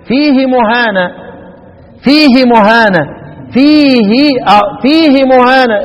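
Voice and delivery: "فيه مهانة" with the kasra on the ha only briefly touched; snatched kasra. Shown as a demonstration. Teacher drills the snatched kasra in front of students. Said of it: "اختلاس".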